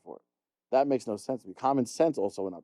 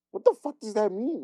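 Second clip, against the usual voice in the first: much higher, incredulous cry; high baffled; 12 semitones higher than usual. Retold or imitated. Asking the kid at work, high incredulous cry. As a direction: squeak it out in total disbelief. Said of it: "I'm like".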